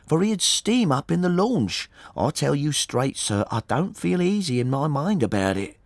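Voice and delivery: high, insistent woman's voice; uneasy boatman's wife. A narrator's reading. Wife tells the gentleman of her unease, insistent. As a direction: pour out the worry, pitched well up.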